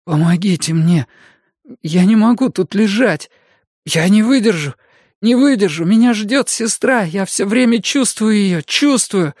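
put-on high, frantic young man pleading; desperate youth begging for help; Mikael panicking.

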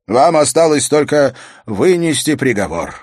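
high, ringing formal declaration; court official announcing guilt. Acted. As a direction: announce the verdict to the throne. Chamberlain urges the king to sentence.

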